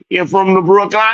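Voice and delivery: high lilting Jamaican question; mock Jamaican accent. Asking again about Brooklyn in a mock Jamaican accent. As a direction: bounce it like the driver. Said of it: "I said".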